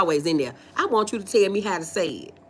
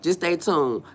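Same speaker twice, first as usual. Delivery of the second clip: normal talking voice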